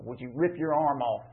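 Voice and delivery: normal talking voice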